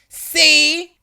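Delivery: high, loud, drawn-out gotcha; triumphant. A narrator's reading. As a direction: crow it as if the argument is won. Angry lady triumphantly showing lanyard.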